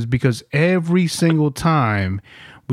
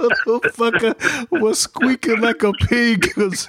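laughing through words; 8 semitones higher than usual. Real, not acted. Laughing while telling.

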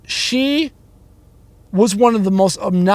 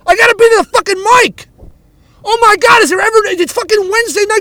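angry rant; 7 semitones higher than usual. Real, not acted. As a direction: blow up at the concert crowd, voice cracking high. Ranting about concert noise outside.

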